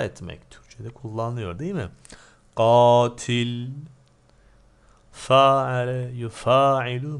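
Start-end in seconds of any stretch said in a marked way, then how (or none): 2.6-7.2 loud recitation of forms. Shown as a demonstration. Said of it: "müfaale babından".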